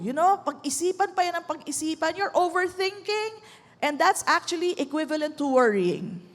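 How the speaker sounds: usual speaking voice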